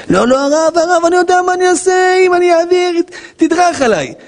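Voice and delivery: high, pleading protest voice; protesting congregant. Pretend. Follower refuses the rabbi's advice in a high protesting voice. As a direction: play the stubborn follower begging off.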